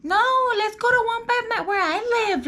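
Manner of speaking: high mocking voice for him; mock him in a squeaky high voice; mocking boyfriend. Mocking the boyfriend who picked his own area, in a high mock voice. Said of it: "I literally kept telling him".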